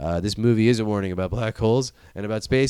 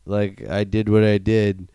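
slow apology; slower than usual. Retold or imitated. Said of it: "And he's just like".

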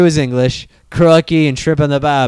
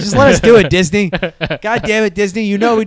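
mock plea; 6 semitones higher, 5 dB louder than usual. Real, not acted. Pleading with Disney.

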